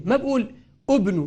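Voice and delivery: usual speaking voice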